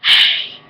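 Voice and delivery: very loud voiceless burst; imitated ghostly whispered shout; whispered yell. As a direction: force a yell out as breath. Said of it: "deep whispered voice yelled".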